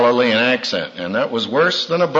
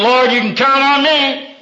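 Peter's boast; 10 semitones higher, 3 dB louder than usual. Retold or imitated. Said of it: "the man who said".

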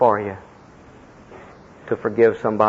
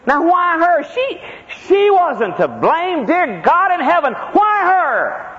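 anguished cry to God; slower than usual. Real, not acted. Preacher cries out about mother's illness.

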